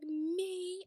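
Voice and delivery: high, excited squeal of Me; giddy Mary. Retold or imitated. Host imitating Mary's giddy surprise. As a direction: mimic a giddy flattered woman. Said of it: "she gets so giddy".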